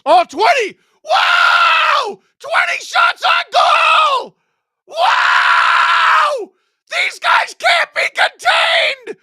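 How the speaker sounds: full-throated, very high mock-hype shrieks; over-the-top screaming. Host mock-celebrating twenty shots on goal, over-the-top screaming. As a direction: scream it in drawn-out bursts like a frenzied fan.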